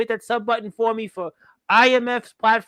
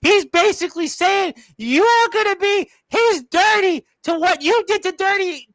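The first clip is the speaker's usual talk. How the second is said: high, loud, incredulous announcer; heated outburst; 8 semitones higher, 4 dB louder than usual. Real, not acted. Host warning the wrestler what Omen intends, heated outburst. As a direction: pitch way up, pushing every point.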